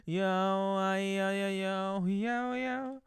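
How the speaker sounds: slow drawn-out cringe yo chant; deliberately cringey rapper. Pretend. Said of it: "I'll be more cringe".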